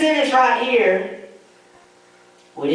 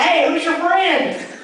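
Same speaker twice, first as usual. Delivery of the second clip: teasing laugh; 6 semitones higher, slower than usual. Retold or imitated. Teasing a stranger about his face.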